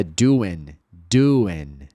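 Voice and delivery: "doing" ending in a plain N; low, clear "doing" with silent G; dropped G. Shown as a demonstration. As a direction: say "doing" as "doo-in", no G. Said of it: "Esse G frequentemente não vai ser pronunciado".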